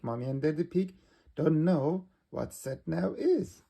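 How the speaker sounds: plain reading voice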